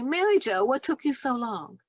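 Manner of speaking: lightly voiced children greeting an old friend; children's greeting. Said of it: "and they will say".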